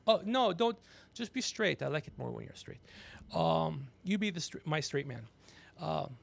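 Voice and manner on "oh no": ordinary delivery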